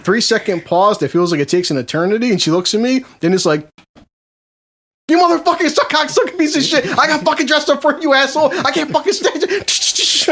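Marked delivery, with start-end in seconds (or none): 5.1-10.3 high-pitched mock tirade; hammed-up outraged cursing; furious rant. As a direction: tear into him as an enraged wife, pitched way up. Acts out wife's furious imagined rant.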